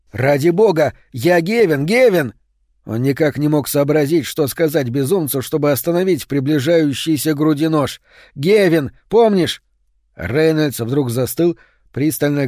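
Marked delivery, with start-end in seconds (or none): 0.1-2.3 louder, higher plea rising on the name; desperate shout. Acted. Man pleading with a madman at knifepoint, desperate shout. 8.3-9.6 louder, higher plea rising on the name; desperate shout. Acted. Man pleading with a madman at knifepoint, desperate shout.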